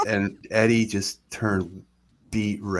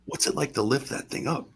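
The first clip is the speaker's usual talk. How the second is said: high-pitched, wonder-struck imitation; awed questioning; 8 semitones higher than usual. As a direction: ask like a starstruck fan, put on. Imitates star quizzing supposed champion.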